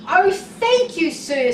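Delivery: high, glad thanks; happily. Acted. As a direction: thank the boss with delight. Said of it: "said Thomas happily".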